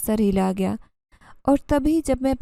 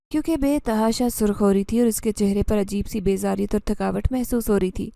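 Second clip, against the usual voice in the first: ordinary delivery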